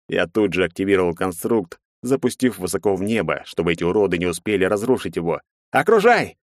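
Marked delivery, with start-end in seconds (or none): 5.7-6.4 high, loud order; shouted command. A narrator's reading. Герцен orders the men to surround the tree, shouting. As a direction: call out the order sharply and high. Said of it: "дал команду Герцен".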